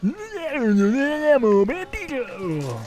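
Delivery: high, very slow sung-out announcer call; vending machine voice. Retold or imitated. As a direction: stretch out ammo bandito like the machine. I hear an ammo bandito.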